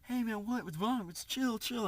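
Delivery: high, anxious imitation of yourself; mock-nervous attempt to calm someone down; retold scared pleading. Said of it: "I'm, like, shaking at this point".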